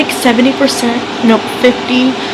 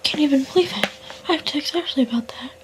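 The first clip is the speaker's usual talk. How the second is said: stunned whisper; hushed, dazed after the ending; 11 dB quieter than usual. Real, not acted. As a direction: whisper in disbelief. Whispering after finishing the book.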